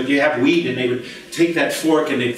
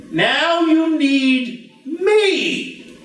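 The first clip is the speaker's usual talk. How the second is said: very slow, drawn-out; 10 semitones higher, much slower than usual. Retold or imitated. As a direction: stretch every word slowly like God speaking. God's voice, drawn out.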